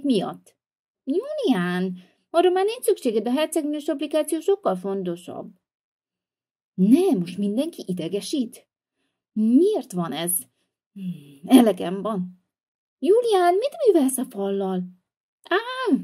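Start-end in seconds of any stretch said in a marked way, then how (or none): 1.0-5.5 put-on little girl voice. Little sister voice, princess app. 13.0-14.8 high scolding voice. Acted. Sister finds broken wall. 15.4-16.0 shocked gasp. Acted. Wall knocked down.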